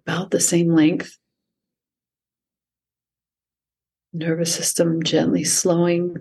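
calm, unhurried meditation voice with long pauses; guided meditation. Acted. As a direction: guide the listener gently into stillness. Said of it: "lead us through any sort of meditation".

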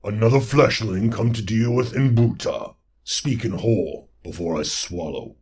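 a booming, monstrous toad lord; loud croaking monster voice; bellowing toad creature. Acted. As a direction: bellow it like a giant swamp beast. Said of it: "A voice bellowed".

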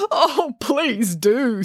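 slow, high, hammed-up sob; acted whimpering manager; sobbing plea. A narrator's reading. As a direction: draw it out in tearful relief. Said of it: "sobbed the manager".

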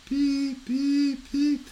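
three high, even electronic beeps; steady high-pitched pips; imitated monitor beep. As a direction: mimic a heart monitor going off. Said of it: "hear her thing going".

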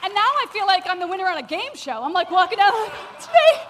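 loud, high, exuberant delivery; game-show winner. Acted. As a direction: whoop it up like a winning contestant.

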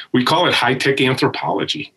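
normal talking voice

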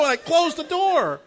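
high, loud, laughing disbelief; retelling the shock of walking in on someone. Retold or imitated. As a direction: tell it like you still can't believe it.

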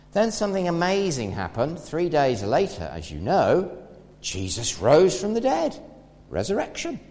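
ordinary talk, nothing marked

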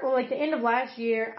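usual speaking voice

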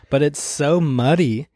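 ordinary talk, nothing marked

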